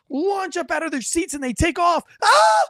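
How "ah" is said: high screams that fade into a frantic plea; scream, then beg someone to call for help; imitated panicked screams. Imitating the women on the bench fleeing the kid, screaming high.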